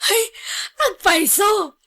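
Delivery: high, slow, tearful cry of joy; overjoyed cry. Acted. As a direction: gush with overwhelmed gratitude. Employer overjoyed, crying out thanks.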